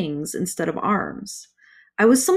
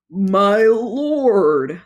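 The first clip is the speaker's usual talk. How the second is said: louder servile creature voice; groveling toad; 6 dB louder than usual. A narrator's reading. Toad greets the fiery lord, groveling creature voice. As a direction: grovel to the master.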